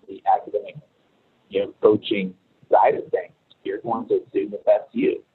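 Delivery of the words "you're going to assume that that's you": ordinary talk, nothing marked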